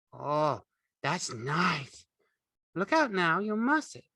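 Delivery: pleased then wary; Curly's wife. A narrator's reading.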